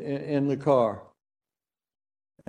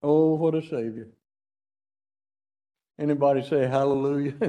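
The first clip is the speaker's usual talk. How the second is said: ordinary delivery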